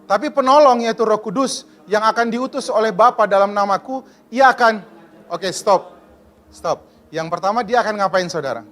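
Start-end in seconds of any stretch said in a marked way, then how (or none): 0.0-4.8 loud, declaiming group reading of scripture; loud scripture recitation. Acted. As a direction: read it out boldly with the congregation. Reads scripture aloud together.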